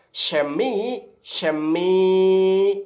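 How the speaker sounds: Shemi, shemi read out in Hebrew, loud and drawn out; reading Hebrew aloud. Shown as a demonstration. Preacher reading Hebrew scripture to the congregation, loud and deliberate. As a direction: sound out Shemi, shemi as Hebrew text. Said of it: "coba saudara lihat di dalam bahasa Ibrani ini".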